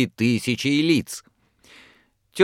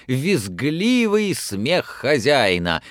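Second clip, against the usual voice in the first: usual speaking voice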